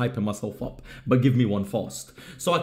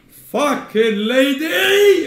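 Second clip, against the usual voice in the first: very high, very loud, slow drawn-out yell; stretched comic outraged scream; furious shout; 13 semitones higher, 8 dB louder, much slower than usual. Pretend. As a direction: blast it out in mock fury. Mock outrage ending the story.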